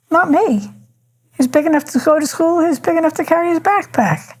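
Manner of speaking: high, firm no-nonsense mother; firm refusal. Retold or imitated. Mother retelling her words to parents.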